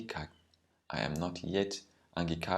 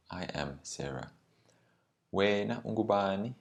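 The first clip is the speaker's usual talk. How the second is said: ordinary talk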